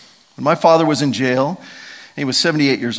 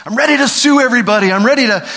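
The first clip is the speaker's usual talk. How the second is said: mock fury; 8 semitones higher, 6 dB louder than usual. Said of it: "He could have been in a place going".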